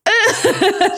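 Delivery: sharp high loud "ah" of disgust; cringing yelp; cringing cry. Retold or imitated. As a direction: recoil with a cry. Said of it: "I was like".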